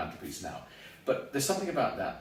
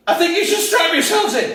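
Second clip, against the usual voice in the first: much higher, louder alarmed warning; hammed-up panic in the cockpit; panicked pilot; 15 dB louder than usual. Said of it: "hear the pilot saying".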